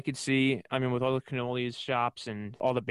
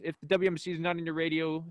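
usual speaking voice

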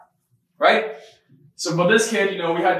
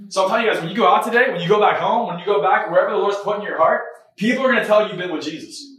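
loud, higher, rousing preacher delivery; preaching charge; 5 semitones higher, 6 dB louder than usual. Real, not acted. Preacher sending congregation out with a promise, preaching charge. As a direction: drive each phrase home to the crowd.

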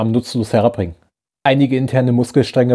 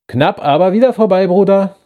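higher, louder mocking taunt; taunting rival; 8 semitones higher, 4 dB louder than usual. A narrator's reading. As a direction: needle him like a cocky old friend. Piotr taunts Elon, raised voice. (höhnischer Rivale, höher und lauter)

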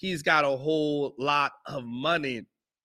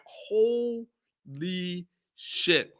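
very slow and drawn out; stunned disbelief; 3 dB quieter, much slower than usual. Retold or imitated. Me hearing what the dude just said, slowly stunned. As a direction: let it hang, stunned. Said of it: "I'm like".

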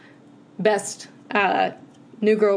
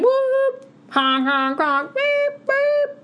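very high car alarm noises; whoop, honk and beep like a car alarm; silly clown; much slower than usual. Pretend. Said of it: "Car alarms".

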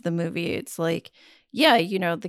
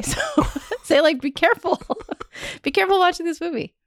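laughing through tears, higher and faster; emotional laughter; 8 semitones higher, faster than usual. Real, not acted. Choked-up mom laughs while warning listeners about the movie. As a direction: laugh to keep from crying, then warn them. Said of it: "I actually am getting emotional".

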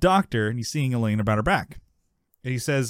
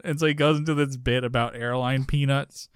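ordinary delivery

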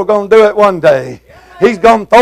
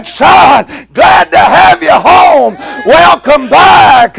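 welcoming father; 7 semitones higher, slower than usual. Retold or imitated. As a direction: call out to the returning son with open arms. Father welcoming prodigal home.

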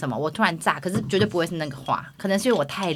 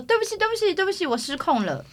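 high, soft begging; kneeling apology; 12 semitones higher, 4 dB quieter than usual. Retold or imitated. He kneels before his wife, begging softly and high. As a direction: plead sorry on your knees. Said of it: "跪下来说".

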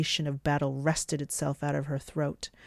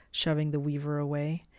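ordinary delivery

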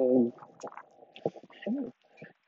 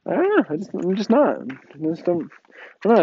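higher-pitched, halting brush-off; imitated shrug-off; 4 semitones higher than usual. Brother answering mom about being scared, imitated shrug-off. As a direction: imitate a kid casually dodging the question.

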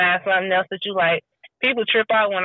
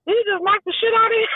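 high, fast amazed exclamation; excited reaction; 13 semitones higher, faster than usual. Retold or imitated. Woman watching her first hockey fight, excited exclaim. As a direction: blurt it out at the big hit.

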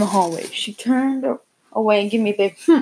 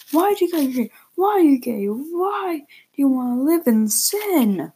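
higher, slowing angry questions; angry dad; 6 semitones higher than usual. Acted. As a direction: hammer the questions at the son. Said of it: "my dad said angrily".

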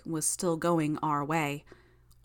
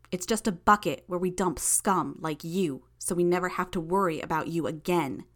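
angry shouting; 2 semitones higher, 3 dB louder than usual. Acted. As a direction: rant at them with contempt. Hero berating villains, shouting.

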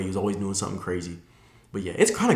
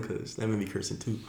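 ordinary delivery

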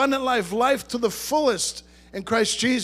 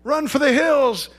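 mock alarm; 6 semitones higher, much slower than usual. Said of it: "the media is saying".

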